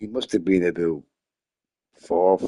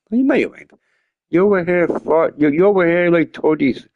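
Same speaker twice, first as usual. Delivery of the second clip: high, loud disbelief; incredulous friend; 7 semitones higher, 3 dB louder than usual. Retold or imitated. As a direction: question it in mock shock. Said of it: "And he's like".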